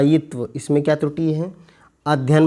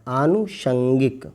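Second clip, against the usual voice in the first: very slow model word; much slower than usual. Shown as a demonstration. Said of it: "आनुषंगिक होता है".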